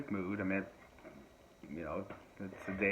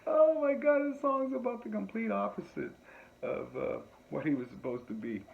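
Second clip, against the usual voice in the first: high, loud disbelieving outburst; incredulous; 12 semitones higher, 4 dB louder than usual. Retold or imitated. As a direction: react in amazed disbelief. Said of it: "It's like".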